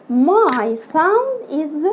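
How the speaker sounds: very slow teaching voice; slow drawn-out demo. Shown as a demonstration. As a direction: stretch each word slowly. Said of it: "teacher demo, slow".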